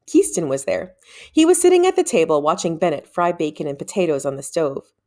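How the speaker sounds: plain reading voice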